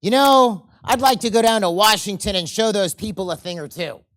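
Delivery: blustering angry complaint; angry guy. Acted. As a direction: bark it out like a man fed up with Washington. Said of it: "this is the angry guy".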